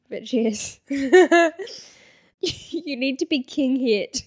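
normal talking voice

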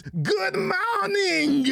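high, slow, drawn-out shouted good morning; imitating Japanese greeting. Imitating the president's morning greeting to fans. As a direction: belt it out like an anime wake-up call. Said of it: "and in Japanese".

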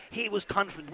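normal talking voice

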